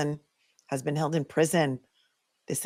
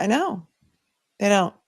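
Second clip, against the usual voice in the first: usual speaking voice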